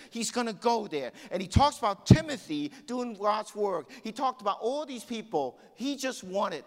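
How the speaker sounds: high, loud fervent sermon; impassioned preacher in full flow; fired-up preaching. Acted. Preaches on Paul at full force.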